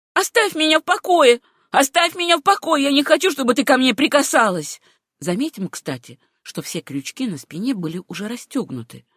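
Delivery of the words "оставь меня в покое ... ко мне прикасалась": loud, high, tearful pushing away; a wailing plea to be left alone; sobbing outburst. A narrator's reading. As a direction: shove her off with the words. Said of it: "Она вдруг зарыдала и".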